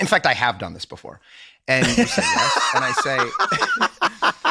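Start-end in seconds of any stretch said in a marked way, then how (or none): 1.7-4.5 words squeezed out through real laughter; high, slow, breaking into laughter; laughing. Real, not acted. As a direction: giggle your way through it. Laughing while retelling.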